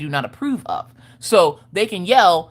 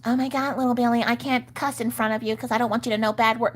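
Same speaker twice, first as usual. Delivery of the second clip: high, soft, fussy pearl-clutching voice; a mock uptight parent, raised and hushed; mock prissy parent; 5 semitones higher, 4 dB quieter than usual. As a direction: clutch your pearls over cussing.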